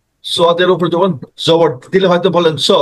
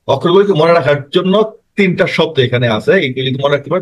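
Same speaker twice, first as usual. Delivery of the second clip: usual speaking voice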